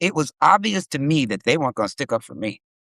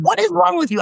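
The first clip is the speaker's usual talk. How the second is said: much higher, sharp disbelieving cry; exasperated scold; 3 dB louder than usual. Retold or imitated. Girlfriend scolding him in the restaurant, high exasperated cry. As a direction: demand an answer, fed up.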